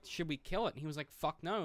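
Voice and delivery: ordinary talk, nothing marked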